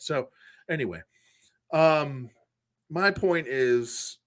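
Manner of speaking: usual speaking voice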